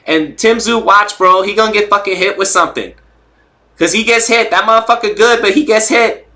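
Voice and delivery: high-pitched hyped boxer impression; Rolly. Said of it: "the Rolly impersonation is A one".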